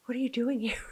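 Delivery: hushed, breathy whisper of a question; a secret whisper to Mother Mary; telepathic whisper. Retold or imitated. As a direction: whisper it as if speaking mind to mind. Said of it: "and I said telepathically".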